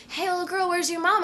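lightly voiced friendly woman; concerned woman stranger. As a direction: gently address a lost child. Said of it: "She's like".

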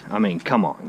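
usual speaking voice

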